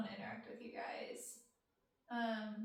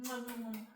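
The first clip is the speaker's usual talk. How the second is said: kiss sounds. Real, not acted. Blowing kisses to a fan.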